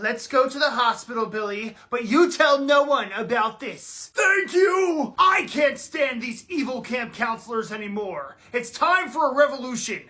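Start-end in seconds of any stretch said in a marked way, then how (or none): none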